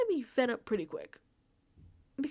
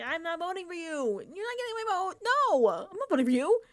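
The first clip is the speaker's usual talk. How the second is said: higher, rising to louder flat refusal; emphatic refusal; 8 semitones higher, 5 dB louder than usual. Pretend. Juror refusing a scared finalist, higher and indignant. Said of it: "and I'd be like".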